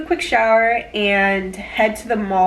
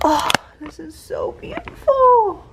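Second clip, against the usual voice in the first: much higher, awed and breathless; 12 semitones higher, 3 dB louder than usual. Real, not acted. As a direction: gush over the view, high with awe. Awed by the rainbow.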